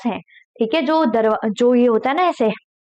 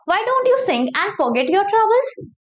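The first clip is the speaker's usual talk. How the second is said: slow, higher read-aloud of the princess's line; story reading; 7 semitones higher, much slower than usual. Acted. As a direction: coax the bird slowly. (धीमी, ऊँची कहानी पढ़ाई)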